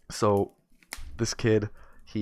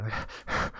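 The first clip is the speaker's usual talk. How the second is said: hyperventilating; 5 dB quieter than usual. Acted. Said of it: "he starts hyperventilating".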